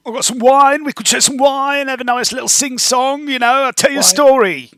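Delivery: high, cheery goblin voice; high goblin offering wine and a story. Acted. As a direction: tempt them with wine in a squeaky goblin voice.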